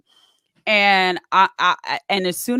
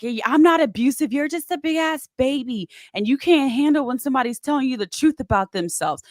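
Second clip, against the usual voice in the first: imitating a higher-pitched angry rant; mock going off; 6 semitones higher than usual. Ex belittling her in front of her dad, mock going off. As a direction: lightly act out his scornful tirade. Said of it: "just starts going off on me".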